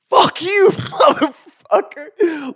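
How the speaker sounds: very high, loud furious yell; enraged retold shout; cussing out. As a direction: scream the curse, stretching it out. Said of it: "So I just cussed him out".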